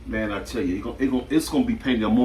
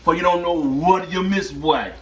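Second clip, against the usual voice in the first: put-on loud, higher Jamaican accent; playful Jamaican impression, raised and punchy; 5 semitones higher, 5 dB louder, slower than usual. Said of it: "Jamaican voice".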